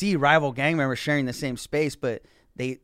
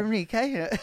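hammy stage actor; 8 semitones higher than usual. Pretend. Joins the mock Renaissance bit.

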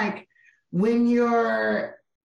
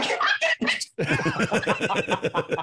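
squeaky starstruck echo; much faster than usual. Retold or imitated. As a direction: shrink into a squeaky overwhelmed fan. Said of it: "I was like".

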